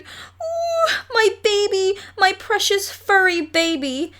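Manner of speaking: high, gushing coo to a puppy. A narrator's reading. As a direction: coo over the baby like it's precious. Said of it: "she cooed".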